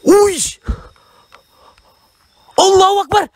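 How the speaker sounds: sudden loud, high startled cry. Real, not acted. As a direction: jump at the sound and blurt it out. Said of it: "Aku terperanjat". (terkejut, menjerit kuat)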